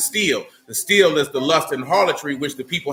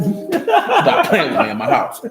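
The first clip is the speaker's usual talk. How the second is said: slow, half-laughing rebuke; laughing scold; slower than usual. Real, not acted. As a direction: scold him but the laugh breaks through. Scolding a child while laughing.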